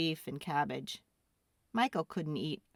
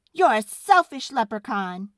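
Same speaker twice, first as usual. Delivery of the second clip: put-on louder angry kid; angrily; 5 semitones higher, 11 dB louder than usual. Boy angrily accusing the leprechaun, loud. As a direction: hurl it at him in anger. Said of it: "Michael said angrily".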